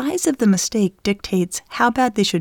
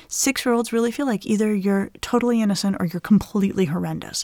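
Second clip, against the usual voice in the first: ordinary talk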